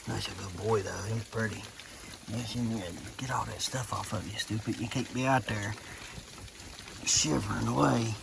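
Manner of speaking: soft, affectionate talk to a goat; soothing an animal. Real, not acted.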